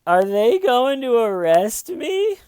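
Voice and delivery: high, loud, drawn-out wail; mock fearful caller. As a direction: imitate a panicky caller, hammed up and slow. Acting caller dreading her arrest.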